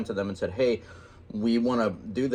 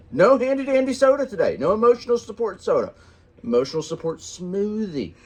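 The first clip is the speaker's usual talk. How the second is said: teasing; 8 semitones higher, 5 dB louder than usual. Real, not acted. Teasing wife about soda.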